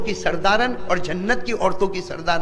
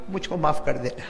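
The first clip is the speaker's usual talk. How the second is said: soft plea; 6 semitones lower, 6 dB quieter than usual. Acted. Dying wife begs forgiveness.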